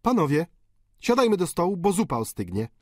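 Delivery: high, loud landlady's call. A narrator's reading. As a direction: call the men to dinner. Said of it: "zawołała pani Wauquez".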